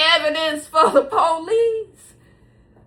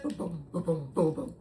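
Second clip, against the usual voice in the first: low, soft booms; quick muted fight sound effects; imitated punching noises; 9 semitones lower, 11 dB quieter than usual. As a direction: mouth the blows low and quietly.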